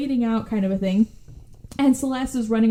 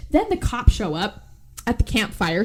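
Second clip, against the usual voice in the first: ordinary talk, nothing marked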